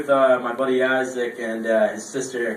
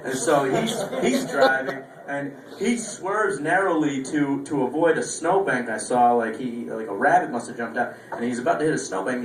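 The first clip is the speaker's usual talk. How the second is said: ordinary talk, nothing marked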